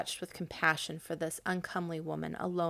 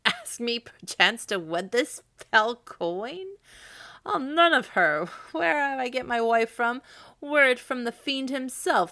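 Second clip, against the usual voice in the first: loud, higher, scornful refusal; angry outburst; 6 semitones higher, 6 dB louder than usual. A narrator's reading. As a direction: burst out in outraged scorn. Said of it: "Sir Kay burst out with".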